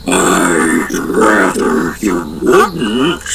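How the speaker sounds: deep, slow, gravelly bear voice. A narrator's reading. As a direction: rumble it like a talking bear. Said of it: "in a deep, gravelly voice".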